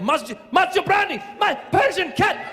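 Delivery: put-on high, frantic excited fan with accent; fan losing his mind. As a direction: imitate a crazed fan meowing and woofing. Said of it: "this guy just lost his mind".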